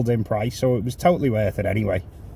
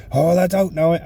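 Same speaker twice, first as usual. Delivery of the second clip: mock frustrated outburst, higher and louder; 8 semitones higher, 6 dB louder than usual. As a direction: imitate a passenger losing patience. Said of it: "She's getting frustrated at me".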